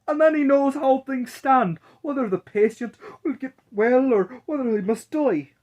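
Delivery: high, loud amazed crowd voice; excited townsfolk. Acted. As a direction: marvel out loud like gossiping townsfolk. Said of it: "everyone said".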